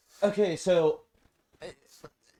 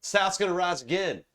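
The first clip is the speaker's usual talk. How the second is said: put-on higher-pitched Southern boy boast; 7 semitones higher, 3 dB louder than usual. Philip the Southern boy in a break-room joke, boasting. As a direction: mimic a Southern boy proclaiming. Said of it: "Southern boy".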